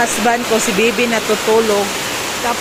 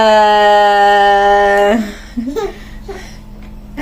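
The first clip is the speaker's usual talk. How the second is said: bright laugh breaking into a cry-like squeal. Real, not acted. Laughing at baby.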